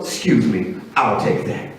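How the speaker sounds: low, understated claim on someone's money; mock casual taker. Stranger taking a worker's paycheck, mock casual. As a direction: play the taker low and matter-of-fact.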